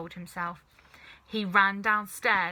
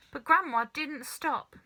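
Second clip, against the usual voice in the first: ordinary delivery, nothing marked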